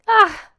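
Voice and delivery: sudden high loud sobbing cry. A narrator's reading. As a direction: let out a burst of tearful emotion. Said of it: "sobbed Missus Deland".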